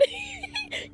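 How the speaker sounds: high, soft giggle; laughing after the jab. Real, not acted. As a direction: laugh at yourself, high and light. Laughing at her own rant.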